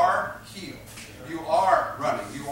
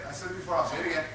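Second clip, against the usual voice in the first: usual speaking voice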